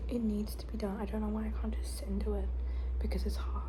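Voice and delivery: whispered, weary self-talk; stressed whisper. Real, not acted. Student stressing over unfinished assignments late at night, in a weary whisper. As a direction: whisper it to yourself, worn out.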